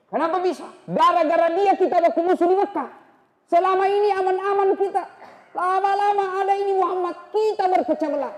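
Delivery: high, loud furious ranting; angry shout. Acted. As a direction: shout your grievance, pitched well up. Umar explains his anger.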